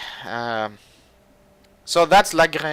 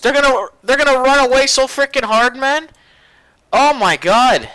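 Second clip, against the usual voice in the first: high, loud, incredulous rant; excited disbelief; 10 semitones higher, 5 dB louder than usual. Real, not acted. Gamer marvelling at citadels you can't miss, excited disbelief. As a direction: hype it up high, hitting HUGE hard. Ranting about unmissable huge citadels.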